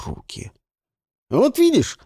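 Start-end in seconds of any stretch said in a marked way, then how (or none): 1.3-2.0 higher and louder, suddenly eager; perked up. A narrator's reading. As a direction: jump in with fresh eagerness. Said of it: "оживился Жуан".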